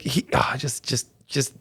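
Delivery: ordinary delivery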